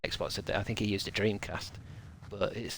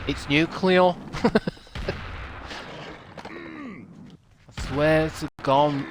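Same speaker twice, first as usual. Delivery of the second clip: talking candlestick; 5 semitones higher, 4 dB louder, much slower than usual. Shown as a demonstration. Repeats candlestick voice.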